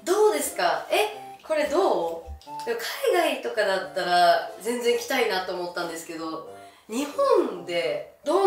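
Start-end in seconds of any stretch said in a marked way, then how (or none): none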